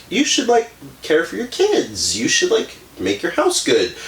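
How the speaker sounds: high-pitched mock advice; good-wife nagging. Good wife always telling Edna what to do, mock nagging. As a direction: imitate a preachy good wife, voice lifted high. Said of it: "the good wife who's, like, always telling Edna".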